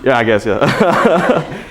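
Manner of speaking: slow, amused words through a laugh. Real, not acted. Reader laughing along with the audience at the title. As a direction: let the laugh carry the words.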